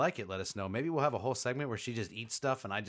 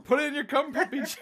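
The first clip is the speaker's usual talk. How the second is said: laughing; 7 dB louder than usual. Real, not acted. Laughing at the pun.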